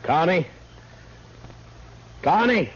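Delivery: anxious call for Connie after the shooting. Acted. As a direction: call out for her, searching and worried. Calling after the gunfight.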